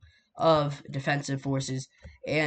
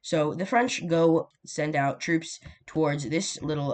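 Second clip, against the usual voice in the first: ordinary talk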